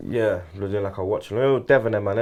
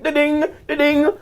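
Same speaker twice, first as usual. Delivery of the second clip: mock bell sound; 11 semitones higher, slower than usual. Imitating speed dating bell.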